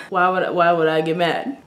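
ordinary talk, nothing marked

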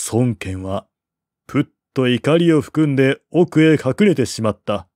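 ordinary delivery, nothing marked

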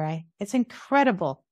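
normal talking voice

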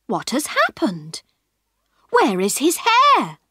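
plain reading voice

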